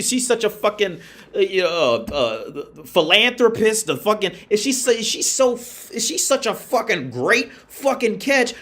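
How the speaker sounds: ordinary delivery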